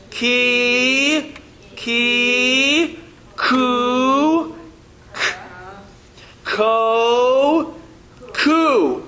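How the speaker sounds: each syllable called out slowly for the class to repeat; syllable drill. Shown as a demonstration. Teacher leads the class in a slow chanted syllable drill. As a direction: lead the class through the drill one sound at a time.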